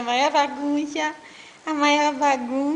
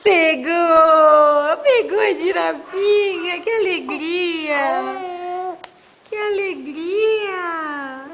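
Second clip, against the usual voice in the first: cheering; 7 dB louder, slower than usual. Real, not acted. Mother cheering loudly as baby grabs the giraffe. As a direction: celebrate the catch out loud.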